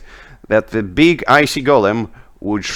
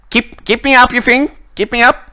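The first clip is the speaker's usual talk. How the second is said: high, urgent witch voice; Lilith; 12 semitones higher, faster than usual. Acted. Witch ordering the thing to keep her up, high urgent cry. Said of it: "But she's like".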